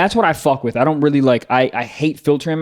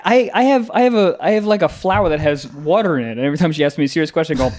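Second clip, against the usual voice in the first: normal talking voice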